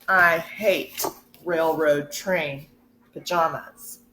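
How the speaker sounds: usual speaking voice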